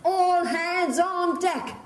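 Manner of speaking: slow, high drawn-out call; bosun's cry. Retold or imitated. Host showing how the bosun calls the crew. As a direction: stretch it out like a ship's cry. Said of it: "She's going to cry out".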